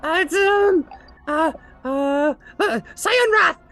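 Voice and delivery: high squeaky, pained then rambling; hurt kobold. Acted. Kobold spilling secrets after the threat, squeaky.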